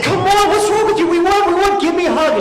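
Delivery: very high, loud, squealing excitement; frantic pleading. Retold or imitated. Fan begging his fiancée for a hug, frantic excitement. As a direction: plead at the top of your pitch.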